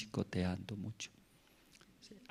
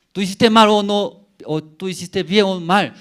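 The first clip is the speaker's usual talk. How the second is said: loud, higher scolding question; stern father; 9 semitones higher, 12 dB louder than usual. Retold or imitated. Father questioning his son, loud stern scolding. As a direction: demand an answer from the child.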